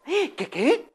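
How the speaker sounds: high, puzzled lady's voice; Señora Amelia. Acted. Owner asking the vendor to repeat, high and puzzled. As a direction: ask high, confused.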